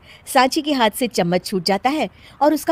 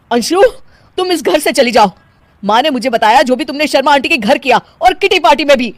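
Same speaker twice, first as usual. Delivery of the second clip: loud, raised stern order; angry; 6 semitones higher, 7 dB louder than usual. Acted. Husband telling wife to leave, angrily. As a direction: throw her out, listing her offences.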